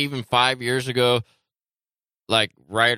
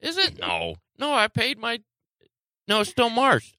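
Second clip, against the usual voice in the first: panicked; 11 semitones higher than usual. Real, not acted. Panicked about late bill.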